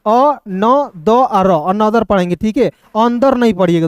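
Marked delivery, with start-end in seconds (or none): 0.0-1.5 अ न द आ र slowly, each sound separate; slow, spaced teaching of अ न द आ र; sounded out syllables. Shown as a demonstration. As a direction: break अ न द आ र into single sounds for learners. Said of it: "देखिए यहाँ पे".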